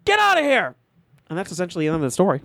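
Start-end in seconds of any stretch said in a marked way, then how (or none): 0.0-0.8 high retold principal yell; shout. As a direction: bark them away, high. Principal chases kids off.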